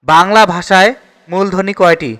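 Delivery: ordinary talk, nothing marked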